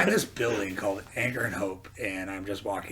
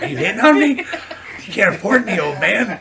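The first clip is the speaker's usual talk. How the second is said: mock indignation; 11 semitones higher, 13 dB louder than usual. Retold chef rebuffs a mistaken proposition.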